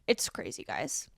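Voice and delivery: breathy whispered aside; whispering. Real, not acted. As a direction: hush it, almost to yourself. Hushed aside after rant.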